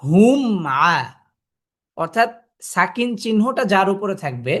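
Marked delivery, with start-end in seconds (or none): 0.0-0.9 হুম held on the closed m before releasing into আ; don't skip the m of হুম, rest on it, then open to আ; হুম closed and hummed, then আ; sounded mim sukun. Shown as a demonstration. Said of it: "যেতে হলে আপনাকে কিভাবে যেতে হবে".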